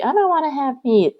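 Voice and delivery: a hint of a stubborn toddler; child voice. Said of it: "she's like".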